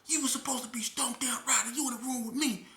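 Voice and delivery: high, loud disappointed yelling; angry scolding. Retold or imitated. Scolding the cellmate for not fighting back, loud and high. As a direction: chew him out loudly.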